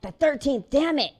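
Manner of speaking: loud, high self-correction; exasperated. Real, not acted. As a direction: curse your own slip. Curses at own mistake.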